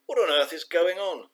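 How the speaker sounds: loud, high, demanding mum. A narrator's reading. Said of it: "demanded Bee's mum".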